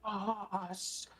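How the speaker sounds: high, far-off servile voice pledging loyalty; devoted henchman. Acted. A character swearing loyalty to the boss in an eager high voice. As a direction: squeak it up like an eager underling.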